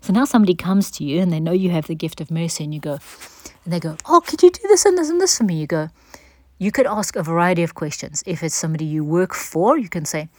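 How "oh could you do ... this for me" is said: much higher, louder, fast wheedling plea; pleading request. Retold or imitated. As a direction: beg sweetly for a favor. Someone pressing extra requests.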